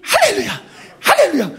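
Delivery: high, ringing hallelujahs; mock pious hallelujah. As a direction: sing out cheerful praise with no grief. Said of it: "이렇게 하면".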